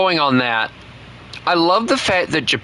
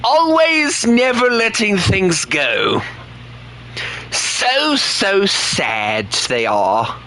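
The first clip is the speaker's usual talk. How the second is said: high, slow teasing; sing-song taunt at the British; mock high taunt; 9 semitones higher, slower than usual. Streamer taunting British viewers in a high mocking voice.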